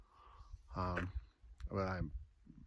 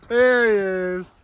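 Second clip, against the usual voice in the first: excited call; 10 semitones higher, 16 dB louder than usual. Real, not acted. Spotting friend, calling out.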